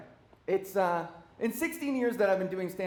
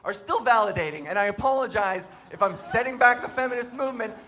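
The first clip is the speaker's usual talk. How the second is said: ordinary delivery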